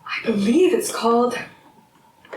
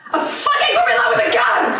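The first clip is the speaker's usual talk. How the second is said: exploding impatience; 4 dB louder, faster than usual. Acted. Armed partner repeats the riddle, erupting into an angry shout. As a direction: hold back, then yell the rest fast.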